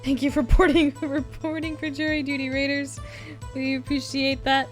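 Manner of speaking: cute anime streamer. Real, not acted. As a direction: chirp a happy thank-you. Thanking raiders.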